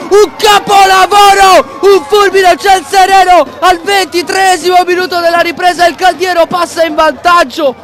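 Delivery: rising, shouted goal call; excited goal commentary. Acted. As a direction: yell the goal call with joy.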